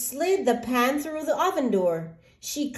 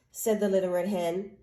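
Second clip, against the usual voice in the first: ordinary delivery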